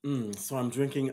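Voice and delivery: normal talking voice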